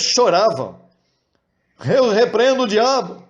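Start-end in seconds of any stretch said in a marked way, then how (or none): 1.8-3.2 mock weeping rebuke.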